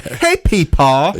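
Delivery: put-on high, loud, slow kid calling Peepaw; little kid voice. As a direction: play a small kid calling out to grandpa in the store. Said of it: "imagine a kid in the store like".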